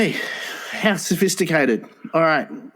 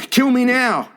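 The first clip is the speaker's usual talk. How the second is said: mock groan; 8 semitones higher than usual. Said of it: "I would".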